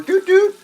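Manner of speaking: high, loud doot doot of a car horn; imitated horn honk. Honking at a driver who ignores him, loud high horn imitation. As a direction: beep it out like a horn. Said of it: "I honk the horn".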